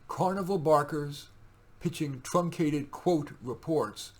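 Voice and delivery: normal talking voice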